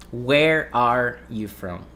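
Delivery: slow careful question with a clear separate "are" in "Where are you from?"; slow, every word separate. Shown as a demonstration. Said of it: "when you say it fast".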